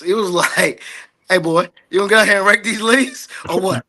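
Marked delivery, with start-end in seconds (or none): 1.3-3.9 gruff dad calling out, higher; mock dad ordering chores; imitating his father telling him to rake leaves; voicing his pops. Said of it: "I think about my pops".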